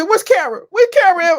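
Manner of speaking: loud call for someone. Retold or imitated. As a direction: shout the name out. Mock calling out in R. Kelly bit.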